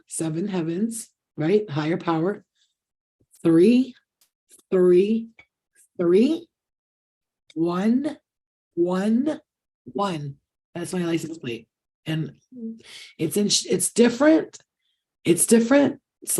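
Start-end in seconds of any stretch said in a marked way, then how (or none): 3.4-10.4 slow and deliberate. Shown as a demonstration. Revealing her license plate to viewers, slow and deliberate. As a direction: spell it out slowly so they really get it. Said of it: "Let me just say this slowly so you really understand".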